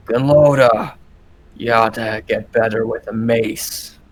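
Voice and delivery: calls the name out loud, then mocks; a brawler jeering mid-fight; dwarf fighter taunting his sparring partner.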